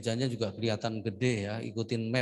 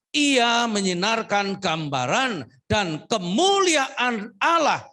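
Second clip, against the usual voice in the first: much higher, louder preaching cry; full-force preaching; 8 semitones higher, 8 dB louder than usual. Acted. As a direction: preach it with fire to the church. (khotbah berapi-api dengan suara tinggi dan keras)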